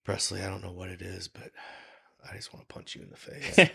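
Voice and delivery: whispered deadpan threat, fast at the end; teasing a friend on the way to the gym, whispered; mock menace whisper. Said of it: "he'd go".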